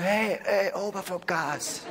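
mildly voiced casual hey, slowing on the last words; a relaxed brush-off, slow down; God telling you to ease off. God answers the flowery prayer, a light casual brush-off. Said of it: "Er wird sagen".

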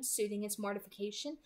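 ordinary delivery, nothing marked

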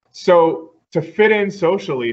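ordinary talk

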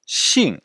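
xing dropping sharply from high; falling-tone xing. Shown as a demonstration. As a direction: teach xing with a steep fourth tone. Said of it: "C'est écrit X I N G". (xing au quatrième ton, qui tombe de haut)

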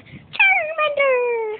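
very high, drawn-out slow cry; slow squealing Charmander call; Charmander impression. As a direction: stretch the name into a long creature cry. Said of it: "do Charmander".

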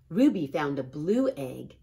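ordinary delivery

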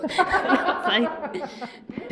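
words broken by laughter; laughing. Real, not acted. Telling the interviewer about the sequel, laughing. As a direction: laugh through it, high.

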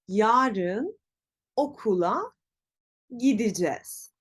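gideceğiz as gi-di-jez; spoken contraction. Shown as a demonstration. Teacher repeats for learners, gideceğiz as gidicez. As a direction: separate chunks, gideceğiz shortened to gidicez. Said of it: "Peki telaffuz nasıl olacak?".

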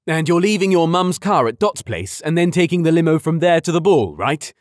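loud, high fatherly check; hearty dad voice. A narrator's reading.

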